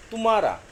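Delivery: second तुम्हारा punched on तुम्; stress on first syllable. Shown as a demonstration. Teacher contrasting two words, तुम्हारा stressed hard on तु. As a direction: give तुम्हारा a strong push on its opening तु. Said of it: "तू पे बलाघात अधिक है".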